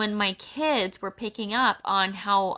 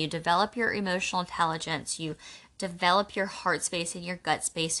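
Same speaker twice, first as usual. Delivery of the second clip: usual speaking voice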